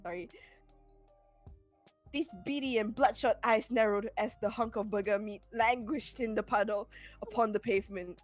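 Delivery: ordinary talk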